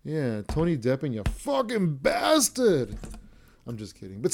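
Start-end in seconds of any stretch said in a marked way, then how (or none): 1.1-3.0 slow, higher, dragged-out mock cry of outrage; hammed-up fan insult. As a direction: wail it as a fake-hurt fan.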